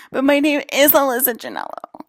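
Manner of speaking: lightly mock the clone claiming her name. Mimicking the clones who claim her name, mild mock prissy voice. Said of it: "You sound like a psychopath".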